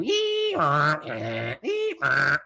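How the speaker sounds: loud high squeal dropping to honks; imitated data-tape screech. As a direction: mimic electronic data noise with your voice. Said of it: "it'd go".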